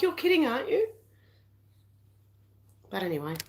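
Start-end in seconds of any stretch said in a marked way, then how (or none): 0.0-0.9 incredulous. Retold or imitated. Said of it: "I'm like".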